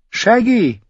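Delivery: high call across the woods; boy calling a wolf in the trees. A narrator's reading. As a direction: call the wolf's name. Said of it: "rief er".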